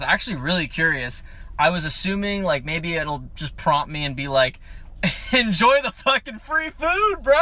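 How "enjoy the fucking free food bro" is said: high-pitched gleeful mock announcement; playfully hyped, pitched up; mock app cheer. Imagines app cheering free food.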